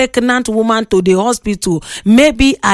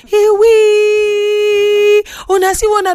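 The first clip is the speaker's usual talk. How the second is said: long, high held wail; exclaiming in mock alarm; 8 semitones higher, slower than usual. Host reacting to listeners' talk, wailing in mock alarm.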